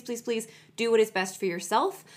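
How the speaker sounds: usual speaking voice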